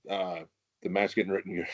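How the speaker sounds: normal talking voice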